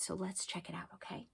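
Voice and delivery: soft conspiratorial whisper; hushed let's-peek whisper. Acted. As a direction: whisper the invitation. Teacher hushed aside.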